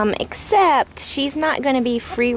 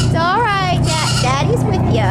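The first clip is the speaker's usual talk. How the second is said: soothing pet talk; 11 semitones higher than usual. Real, not acted. Soothing the chicken on the boat.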